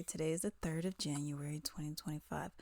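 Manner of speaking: breathy hushed aside; soft secret whisper; whispered date. Real, not acted.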